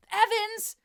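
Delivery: loud, high call across the grounds; boy shouts after the girl walking off, loud and high; shouting after her. A narrator's reading. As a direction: yell her name after her as she walks away. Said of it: "James shouted after her".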